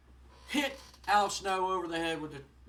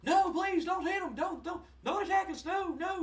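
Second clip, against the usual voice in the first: much higher, panicked pleading; a high, frantic manager begging the wrestler to stop; 13 semitones higher, 4 dB louder than usual. Retold or imitated. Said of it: "Jim Cornette stands in the way and goes".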